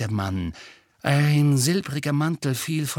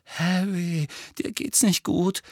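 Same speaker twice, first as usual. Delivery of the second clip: high, worried, wavering voice; shaky; 7 semitones higher than usual. A narrator's reading. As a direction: tell a friend shakily that he is unwell. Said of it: "sagte er zittrig". (zittrig, besorgt)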